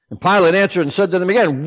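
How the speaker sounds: usual speaking voice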